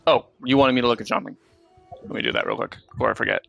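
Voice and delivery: ordinary delivery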